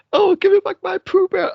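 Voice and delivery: very high, loud hammed-up wail; mock crying plea. Farmer crying over his only friend, in mock anguish. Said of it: "And he's crying over his only friend".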